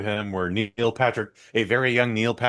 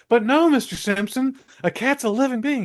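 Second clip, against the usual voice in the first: much higher pleading voice; babysitter; 3 dB louder than usual. Retold or imitated. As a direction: plead in a high girlish squeak. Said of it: "She's like".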